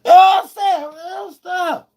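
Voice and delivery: high squeaky tag, then a faint high tail; high yelp. Real, not acted. Streamer tags his point to chat with a high yelp.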